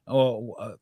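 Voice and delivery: normal talking voice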